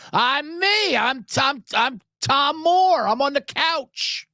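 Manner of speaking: high, slow, self-important caller; couch fan caller. Acted. Fan explains himself to the official, high and slow. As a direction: answer proudly as the nobody on the couch, pitched high.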